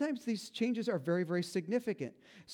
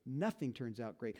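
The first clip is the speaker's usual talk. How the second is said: dropped low and much softer, deadpan; flat and low; 7 semitones lower, 7 dB quieter than usual. Real, not acted. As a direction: undercut the joke flatly. Deadpan correction after mock.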